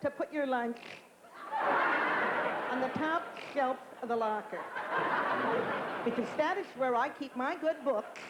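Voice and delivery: high, nagging schoolgirl voice; Effie Fataloni impression. Locker mate scolding Catherine about her lunch, high nagging voice. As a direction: scold your locker mate shrilly from above. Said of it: "because it was my best impression".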